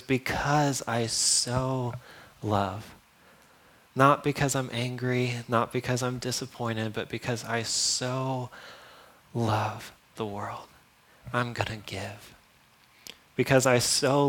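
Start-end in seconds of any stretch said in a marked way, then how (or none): 0.0-2.9 soft, slow tender voice as God. Retold or imitated. Preacher voicing God's love softly, slowly. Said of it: "And he goes". 6.9-12.3 soft and slow; tender. Real, not acted. Preacher to congregation, soft and slow. Preacher softening on God's love.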